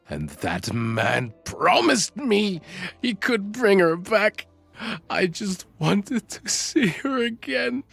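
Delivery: desperate confession, pitch climbing; anguished pleading. Acted. Kidnapper explains his dead beloved to detective, anguished pleading.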